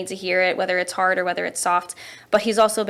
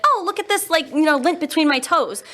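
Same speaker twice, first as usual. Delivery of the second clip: put-on eager kid; 7 semitones higher than usual. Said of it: "'cause you know how kids are like".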